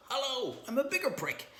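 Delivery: high, soft smug voice; a prim, high mock greeting; mock smug prick. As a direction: play the smug know-it-all. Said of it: "to go".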